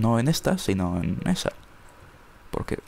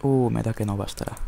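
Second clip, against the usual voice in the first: ordinary talk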